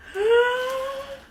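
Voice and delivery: sharp high surprised gasp; a real gasp of delight. Real, not acted. Gasping as the package opens. Opening the Famous Footwear package.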